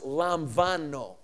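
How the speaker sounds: "lamvano" with a clear V, lahm-VAH-no; teacher modelling "lamvano" syllable by syllable; Greek pronunciation. Shown as a demonstration. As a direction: slowly sound out "lamvano", stressing the middle. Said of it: "But it's pronounced".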